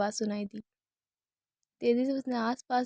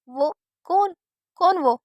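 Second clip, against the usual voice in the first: alarmed, higher and louder; panicked; 6 semitones higher, 6 dB louder, slower than usual. Acted. Sister frightened, asking who died, panicked. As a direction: demand an answer in sudden panic.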